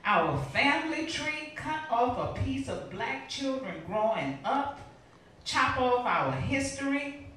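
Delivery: high, forceful spoken-word delivery; dramatic poetry reading at full intensity; impassioned poem recitation. Acted. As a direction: drive the verse hard, pitch lifted.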